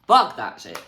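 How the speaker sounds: loud, punched rejection; emphatic dismissal. Real, not acted. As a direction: hit the first word hard and loud. Repeats dismissal much louder.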